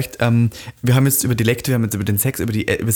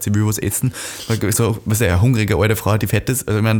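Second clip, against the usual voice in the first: ordinary talk, nothing marked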